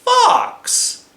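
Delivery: high indignant fox voice. Acted. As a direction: bristle like an offended fox. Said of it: "the fox got a little angry".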